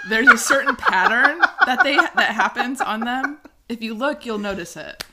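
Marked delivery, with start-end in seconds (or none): 0.1-3.4 high, loud, through giggles; laughing hard. Real, not acted. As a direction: push the words out while cracking up. Breaks up while confessing.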